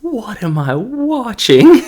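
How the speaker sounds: high, loud, drawn-out mock disbelief; baffled disbelief. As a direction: play up your bewilderment, squeaking slowly. Acted baffled reaction to scene.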